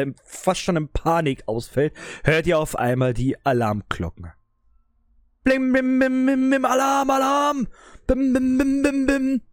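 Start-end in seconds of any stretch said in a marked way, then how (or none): 5.4-9.4 high ringing alarm bell chant; alarm shout. Acted. GM voices alarm bells.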